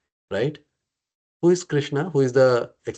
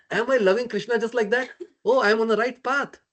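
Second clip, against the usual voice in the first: higher-pitched voicing of a confident inner thought; confident realization; 7 semitones higher than usual. Teacher voicing a devotee checking his love for Krishna, confident. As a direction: act out a believer's pleased self-check, pitched up. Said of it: "That's give the confidence".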